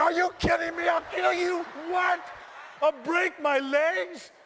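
high, loud frantic shouting; hammed-up shrieked protest, high voice; outraged disbelief. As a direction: shout back in comic panic. Acted outraged reply to instructor.

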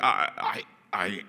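halting, stuck on the word; blank stammer. Pretend. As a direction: imitate going blank, stammering with gaps. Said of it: "you go blank".

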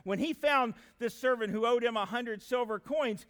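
usual speaking voice

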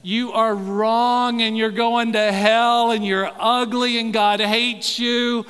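loud, slow condemning shout; street-corner yelling. Retold or imitated. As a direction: bellow it like a street preacher through a bullhorn. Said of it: "bullhorns and would yell at people".

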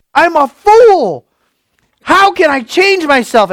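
high, loud, exasperated self-reproach; the man in the mirror scolding himself, loud and high; frustrated self-talk. Retold or imitated. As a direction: berate yourself in the mirror, voice up high. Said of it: "look in the mirror and just say".

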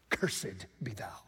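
hushed, breathy curse whispered close; sinister whisper of a curse. Pretend. Preacher voices curse in whisper.

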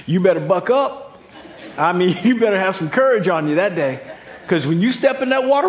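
loud, high words bubbling with laughter; laughing while talking. Real, not acted. Preacher joking about the cold Virginia Beach water, laughing loudly. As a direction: laugh through the warning.

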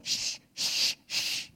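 repeated sharp "shh"; shushing. Retold or imitated. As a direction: shush a noisy baby in a library. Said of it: "He kept going".

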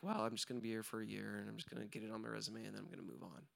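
low, quick mumble trailing away; resigned settling. Acted. Someone playing safe about the job, resigned low mumble. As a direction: rattle it off softly, with low ambition. Voicing a person settling for safety.

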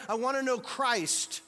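loud high preaching, slow and drawn out; full-force preaching. Acted. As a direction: proclaim it to the back row. Preached take of Paul's words.

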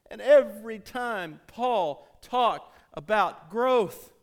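full-force preaching. Acted. Pastor urging the congregation toward Christ, fervent preaching. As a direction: preach it out to the church with mounting fire.

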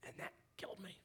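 soft whisper; hushed, hurt whisper; whispered admission. Real, not acted. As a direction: whisper it like a confession. Hushed aside.

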